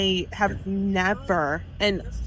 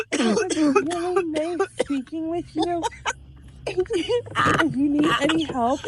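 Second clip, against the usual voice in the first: higher, slow, strained sweetness; flustered saleswoman covering; mock polite clerk; 5 semitones higher than usual. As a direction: sell the awkward politeness, voice pitched up. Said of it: "I was like".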